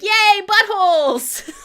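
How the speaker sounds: high, loud, drawn-out cheer with a laugh; hammed-up gleeful hooray; mock cheering. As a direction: imitate an over-excited crowd celebrating. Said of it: "everybody's like".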